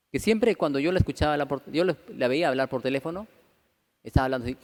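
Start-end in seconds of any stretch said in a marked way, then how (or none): none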